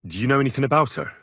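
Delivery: normal talking voice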